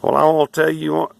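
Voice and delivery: high, loud nasal twang impression; mock East Texas twang. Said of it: "if you ever hear somebody say".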